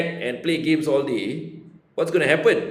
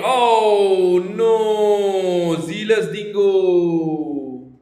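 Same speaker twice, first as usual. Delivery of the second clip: long drawn-out wail of dismay; mock dismay; 3 semitones higher, 4 dB louder, much slower than usual. Mock lament as leader drops.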